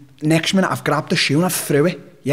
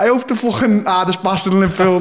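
usual speaking voice

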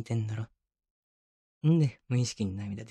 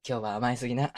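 normal talking voice

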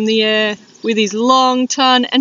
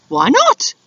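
high-pitched; 12 semitones higher, 3 dB louder than usual. Acted. Dingo asks, very high.

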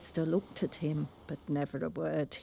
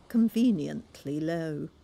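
usual speaking voice

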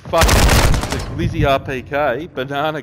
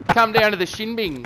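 higher, sing-song ad announcer; mock advert; 8 semitones higher than usual. Inviting the squad to the shin bing like a commercial. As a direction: sell it like a cheesy commercial.

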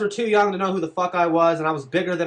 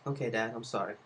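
soft, timid and much quieter; meek apology; 6 semitones lower, 12 dB quieter than usual. Retold or imitated. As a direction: mumble it like a scared kid. Meek reply to an angry dad.

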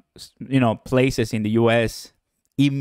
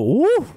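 loud high cheer; excited woo; 3 dB louder than usual. Real, not acted. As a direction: whoop with joy for the chat. Cheering a viewer from Mexico.

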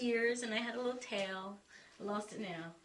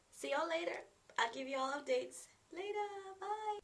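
sing-song goodbye; 9 semitones higher than usual. Real, not acted. Playful sign-off.

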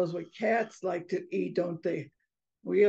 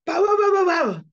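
loud, high rapid-fire barks; dog barking; 10 semitones higher, 10 dB louder than usual. Acted. Mother mouse scaring off the approaching cat, barking. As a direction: bark fiercely like a dog. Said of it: "she did it again".

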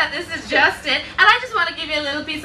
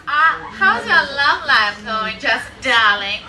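ordinary delivery

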